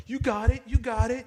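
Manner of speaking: very high cooing encouragement; sing-song baby-talk cheer; cheering a toddler. Retold or imitated. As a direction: coax him like a toddler learning to walk. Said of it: "you hear someone go".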